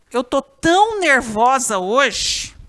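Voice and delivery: "tão" loud and stretched in a slow example; stress on TÃO. Shown as a demonstration. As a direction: punch and hold "tão" to show the intensifier. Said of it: "você vai usar um desses quatro T's".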